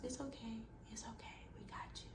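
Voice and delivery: very soft, soothing near-whisper; sweet. Retold or imitated. Nurse rocking a frightened patient, sweet. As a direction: hush her fears gently. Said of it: "Like, so sweet".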